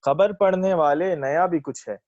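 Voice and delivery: verse lifted above everyday talk; ghazal recitation. Acted. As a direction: present the couplet to a listening mushaira. Recites own ghazal at mushaira.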